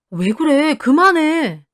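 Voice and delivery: acted exasperated outburst to stop a quarrel; scolding. A narrator's reading. Husband restrains wife mid-quarrel.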